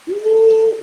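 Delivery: a high, loud whoop of joy; triumphant woo. Real, not acted. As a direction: let out a victorious cheer. Triumphant cry after the student's praise.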